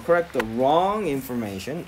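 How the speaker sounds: very slow, word by word; careful slow demo; slow model phrase. Shown as a demonstration. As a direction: read it slowly for learners. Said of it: "teacher models phrase slowly".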